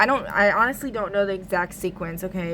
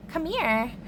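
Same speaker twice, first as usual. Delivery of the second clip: mock alluring; 8 semitones higher than usual. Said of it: "trying to be all alluring".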